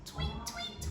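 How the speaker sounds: high, soft whispered tweets; bird tweet. Acted. Morning birds chirp, high and whispered. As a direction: chirp it lightly like small birds. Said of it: "went the birds".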